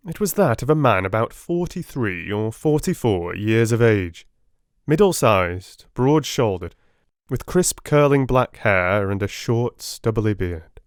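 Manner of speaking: ordinary delivery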